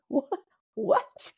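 high squeaky laughing what; mock baffled what. Triggered speaker mocks own confusion, high laughing disbelief. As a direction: play it as comically baffled. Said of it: "I'm like".

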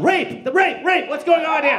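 high, loud cry of alarm; yelled alarm. Retold or imitated. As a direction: yell it out as if calling for help. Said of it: "I yelled".

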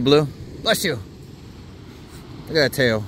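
normal talking voice

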